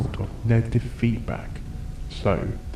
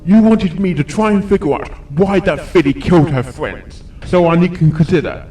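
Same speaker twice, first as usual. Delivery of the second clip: much louder, higher angry outburst; snapping; 7 semitones higher, 10 dB louder than usual. Acted. Doctor snapping at the doubting sheriff, angry outburst. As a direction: snap back at him, furious. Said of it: "He snapped".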